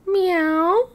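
high, loud meow; cat meow. Acted. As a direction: mew like a cat. Cat noise in story.

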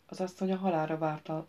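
plain reading voice

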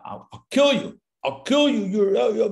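over-the-top retold fury; enraged. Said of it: "just enraged".

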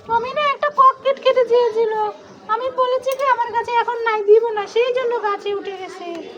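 put-on high little-kid voice, slowing at the end; explaining child figure. Toy child explaining why Tommy climbed, in a high voice.

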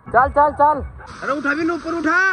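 urging shout. Real, not acted. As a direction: call out to hurry a friend along. Urging friends on at water park. (ज़ोर से पुकारकर उकसाते हुए)

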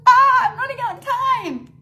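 high, loud hurried-sounding cry; playful panic. Pretend. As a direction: exclaim it as the clock runs out. Recording time running out, playful panic.

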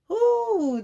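high squeal of delight; high ooh of delight. Real, not acted. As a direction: swoon at the sight of the coat. Seeing the coat.

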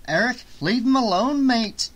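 urgent high protest. Acted. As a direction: tell a friend to back off. Friend intervenes in quarrel.

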